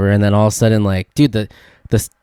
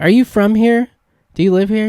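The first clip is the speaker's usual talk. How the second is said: amazed question; 11 semitones higher than usual. Retold or imitated. Asking the stranger amazed.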